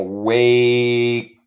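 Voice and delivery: "wakes" very slowly, long vowel then a held "ks"; exaggerated slow "waaay-kssss"; no E sound. Shown as a demonstration. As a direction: stretch "wakes" as one syllable, no vowel before the S. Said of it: "No hay E en la pronunciación".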